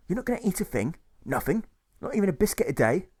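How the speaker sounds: baffled, high-voiced clerk; incredulous clerk. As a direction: question it in rising disbelief. Clerk questions hunger striker.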